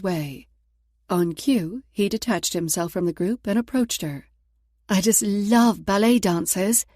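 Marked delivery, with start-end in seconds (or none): 4.9-6.8 a lightly put-on British accent, slow and gushing. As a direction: gush like a fangirl in a mild British accent. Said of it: "using her best British accent".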